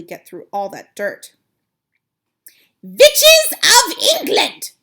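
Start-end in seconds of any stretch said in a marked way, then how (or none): 2.8-4.7 very high, loud, slow witch's screech; commanding witch cry, drawn out; Grand High Witch shout. Acted. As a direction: screech it out like a furious witch queen. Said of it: "shouted the Grand High Witch".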